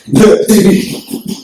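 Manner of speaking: high burst of laughter; hysterical laugh. Real, not acted. Laughing at his own clowning.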